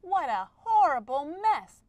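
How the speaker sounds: loud, stressed exclamation. Shown as a demonstration. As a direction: exclaim it with force and dismay. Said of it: "that's an exclamation sentence".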